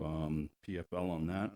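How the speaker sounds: ordinary talk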